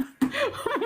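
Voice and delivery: short real laugh. Real, not acted. Laughing after telling about her son, soft laugh. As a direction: laugh it off softly.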